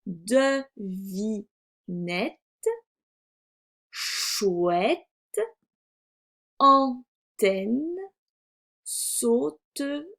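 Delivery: words split into slow syllables; word-list reading. Shown as a demonstration. Teacher reads the second column, slow separated syllables. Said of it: "Donc, le premier mot".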